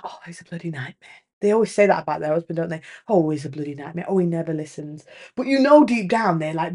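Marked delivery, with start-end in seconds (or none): 0.0-1.2 very quiet, lower grumble imitating a fed-up wife; hushed mock grumbling; muttered complaint. Imitating long-suffering wives grumbling. 3.1-5.0 soft, low grumble imitating fed-up wives; quiet, lower mock grumbling; muttered complaint. Imitating wives complaining about husbands.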